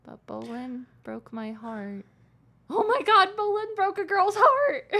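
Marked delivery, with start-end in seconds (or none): 2.7-4.9 high, louder mock sob; hammed-up crying complaint; mock wail. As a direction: wail it like a heartbroken teen. Mock wail over heartbreak.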